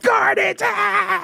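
very high mock crowd scream; imitated crowd shout. People around him react to the heckling, imitated shouting. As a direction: holler the name like an excited crowd. Crowd yelling his name, retold.

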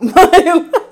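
a high, loud cackle; loud burst of laughter. Real, not acted. Laughs at her own plan.

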